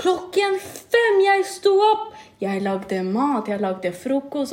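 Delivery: very slow lecturing mock mother; imitating mom. Mom scolding her daughter for sleeping late, very slow mock voice. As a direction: stretch each word out to shame her.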